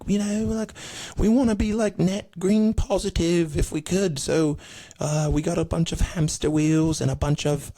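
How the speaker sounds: high-pitched mock frontman, halting pitch. Band asking fans to power shows, high parody voice. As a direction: pitch the green scheme in a high put-on voice. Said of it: "how Coldplay wanted to".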